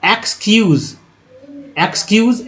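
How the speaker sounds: एक्सक्यूज said as eks-kyooz, repeated; model pronunciation. Shown as a demonstration. Teacher models excuse for students, carefully. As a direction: say एक्सक्यूज with eks and a z ending, twice. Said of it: "उच्चारण क्या होता है".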